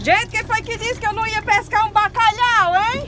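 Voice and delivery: gloating to the doubters; triumphant boast. Real, not acted. Boasting after catching cod. (provocação triunfante e orgulhosa)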